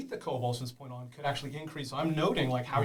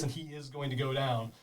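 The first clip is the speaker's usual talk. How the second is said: ordinary talk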